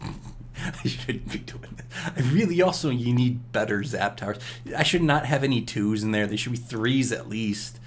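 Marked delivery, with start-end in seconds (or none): 0.0-1.9 slow, whispered guilty aside. Real, not acted. Gamer admitting a bad choice, whispered. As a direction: confess it under your breath.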